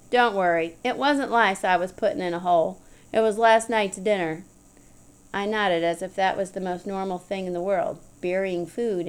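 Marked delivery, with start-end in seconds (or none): none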